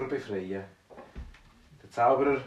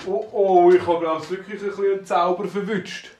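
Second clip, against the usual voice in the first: alarmed dwarf; 4 semitones higher, 8 dB louder than usual. Acted. Fat dwarf realizes he's bewitched.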